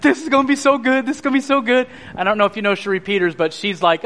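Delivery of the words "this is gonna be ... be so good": high, eager gushing; retold giddy anticipation; imitated giddy excitement. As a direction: imitate a friend thrilled about what is coming.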